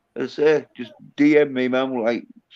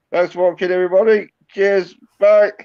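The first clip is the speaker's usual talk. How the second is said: sign-off; 6 semitones higher, 6 dB louder than usual. Real, not acted. Signing off the show.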